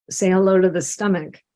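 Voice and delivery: ordinary talk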